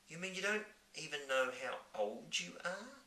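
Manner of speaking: put-on young girl voice. Sophie asks.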